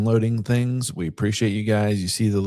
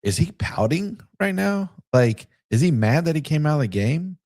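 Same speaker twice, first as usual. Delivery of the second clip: puzzled; 8 semitones higher than usual. Retold or imitated. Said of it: "at first I was like".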